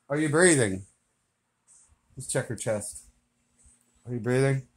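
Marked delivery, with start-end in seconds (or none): 2.2-4.6 hushed. Real, not acted. Whisper near sleeper.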